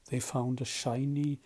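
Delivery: plain reading voice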